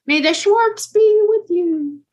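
a mock epic line from the movies; movie quote voice. Co-host punning on the doctor's name, hammed-up movie voice. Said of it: "Star Wars quote pun".

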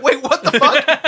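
high, loud, drawn-out disbelief; retold outburst of astonishment; shocked disbelief. Retelling his shock at her comment.

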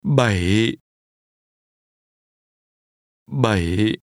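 Bảy with a dip then rise; falling-rising tone. Shown as a demonstration. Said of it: "Remember the falling rising tone".

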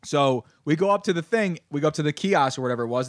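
normal talking voice

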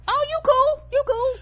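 very high put-on reassurance; mock relieved approval. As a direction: sweetly wave the cashier through. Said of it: "but I was like".

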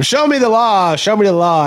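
high mocking whine of an online troll; repeating the heckler's demand in a sneering mock voice. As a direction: mimic a smug heckler. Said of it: "People say that all the time".

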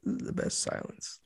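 quiet reverent whisper, fading out; hushed awe. Pretend. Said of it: "People would just be like".

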